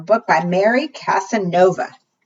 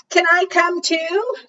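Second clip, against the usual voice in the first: much higher, pleading little dog voice; eager dog; 11 semitones higher, slower than usual. Acted. Dog begging the girl for a canoe ride, high and eager. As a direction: ask sweetly like a hopeful puppy.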